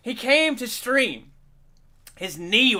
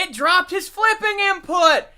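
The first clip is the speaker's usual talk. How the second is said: mock outrage shout; 9 semitones higher than usual. Real, not acted. Egg flip drops.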